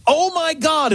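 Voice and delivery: high-pitched excited exclamation; surprised excitement. Retold or imitated. Host repeating the agent's all-caps message, high with surprise and excitement. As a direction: quote it like an amazed discovery. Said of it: "expressing surprise and excitement".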